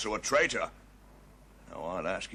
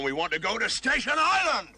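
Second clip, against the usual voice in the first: raised, higher shout; 6 dB louder than usual. Acted. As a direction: shout it out in exasperation. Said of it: "There's no need to shout".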